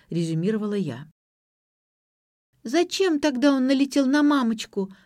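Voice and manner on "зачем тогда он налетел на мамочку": put-on high-pitched little-girl voice; squeaky child. A narrator's reading. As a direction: pipe up like a small child. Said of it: "пропищала Лера".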